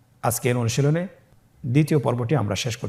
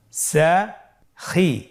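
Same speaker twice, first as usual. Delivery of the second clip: "সাখি" very slowly in two separate syllables; syllables apart; 2 semitones higher, much slower than usual. Shown as a demonstration. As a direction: split "সাখি" with a clear pause between syllables. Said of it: "এভাবেও পড়তে পারেন". ("সাখি" ভেঙে ভেঙে ধীরে)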